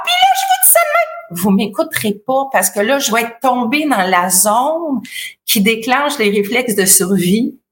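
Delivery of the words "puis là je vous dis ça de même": far higher, fast alarm; panicked shriek. Shown as a demonstration. Coach demos a panicked, shrill alarm voice. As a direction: overdo the panic. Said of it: "si j'augmente encore".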